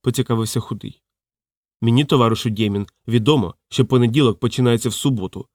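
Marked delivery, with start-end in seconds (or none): none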